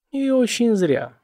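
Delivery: pouting, slow and high. A narrator's reading. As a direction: sulk it out with a pout. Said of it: "Нелл надула губы".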